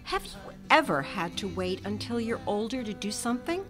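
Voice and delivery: usual speaking voice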